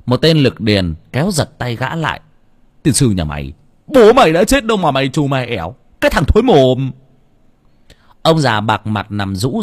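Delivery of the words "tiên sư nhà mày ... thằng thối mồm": loud, indignant cursing; angry scolding. A narrator's reading. Burly villager yanking the son's arm, angrily cursing him. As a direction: chew him out at full volume.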